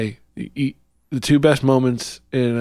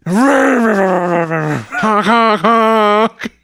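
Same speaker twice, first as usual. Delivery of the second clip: silly clown; 7 semitones higher, 4 dB louder, much slower than usual. Pretend. Senator Dakota votes by honking.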